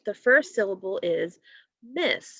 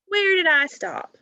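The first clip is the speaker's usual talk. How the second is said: much higher and a bit louder, puzzled dad; dad asking; 9 semitones higher, 5 dB louder than usual. Acted. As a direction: ask it like a playful puzzled dad. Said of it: "said Mike's dad".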